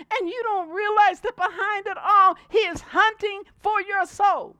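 loud, building sermon delivery; preaching at full force. Acted. Preacher warning congregation about false teachers, loud preaching. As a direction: drive the warning home.

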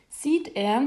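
ordinary delivery, nothing marked

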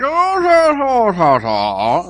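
high cracking "oh", falling off; mock high-pitched Bane; Bane imitation. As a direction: squeal it high then let it drop. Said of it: "high pitched".

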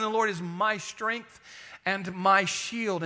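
slow, weighted "my" each time; deliberate, spaced emphasis on both "my"s; stress on MY. Shown as a demonstration. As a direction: stretch "my" before "strength" and "shield". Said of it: "the emphasis on the word "my".

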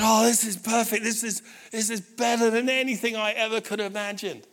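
high, gushing mock delight; thrilled son. Dad imitating son seeing the guitar, thrilled gushing. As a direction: gush like an overjoyed teenager.

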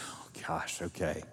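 hushed, breathless gasp of resignation; whispered dismay. Acted. Esther answering Mordecai, whispering in dismay. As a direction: whisper it as if the news just sank in.

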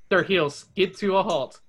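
ordinary delivery, nothing marked

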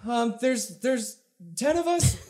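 faint high desperate plea; high, fading call for help; running out of air. Acted. Distress message continues.

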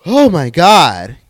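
loud, slow, drawn-out oh my God; stunned reaction. Real, not acted. Host reacting to the clip with a loud, drawn-out gasp. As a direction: let the shock land slowly.

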